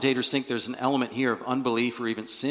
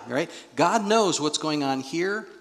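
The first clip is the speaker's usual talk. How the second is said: normal talking voice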